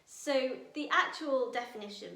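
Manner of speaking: usual speaking voice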